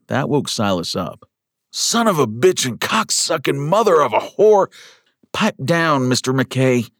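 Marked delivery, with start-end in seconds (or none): 1.7-4.7 high-pitched agonized swearing; pained cursing outburst. Acted. Patient jolted awake by a red-hot knife, pained cursing. Wakes as the wound is burned. 5.3-6.9 high, strained scolding; through gritted teeth. A narrator's reading. Schoolteacher pinning down a thrashing patient, through gritted teeth. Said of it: "Miss Thompson said through gritted teeth".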